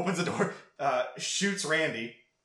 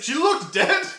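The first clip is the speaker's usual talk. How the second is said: whiny excuse; 11 semitones higher, 6 dB louder, slower than usual. Retold or imitated. Stu's lame excuse to Billy.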